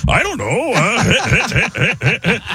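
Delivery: chuckling stoner voice; Seth Rogen. Acted. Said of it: "it becomes Seth Rogen".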